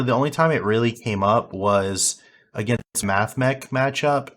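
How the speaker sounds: usual speaking voice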